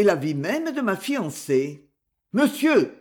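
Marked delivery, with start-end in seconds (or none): 2.3-2.9 sharp, threatening outburst, pitched higher and louder; menacing cry. Acted. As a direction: snap out the word as a warning. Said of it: "s'écria Lionel menaçant". (cri menaçant, plus aigu et plus fort)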